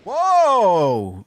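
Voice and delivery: loud, high startled shout; real jolt of surprise, yelled out; startled whoa. Real, not acted. Streamer startled by the game, loud surprised shout. Sudden jump while playing.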